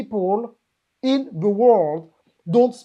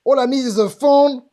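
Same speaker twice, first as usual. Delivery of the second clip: loud, high-pitched mimicry of a business owner; mock naive boasting; 4 semitones higher, 8 dB louder than usual. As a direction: belt it out like an overconfident beginner.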